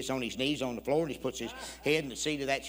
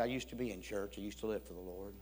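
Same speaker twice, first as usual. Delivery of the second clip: low, hushed confession; subdued, serious testimony; confiding; 5 semitones lower, 11 dB quieter than usual. Retold or imitated. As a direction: retell it softly and soberly. Stranger's sad confession retold.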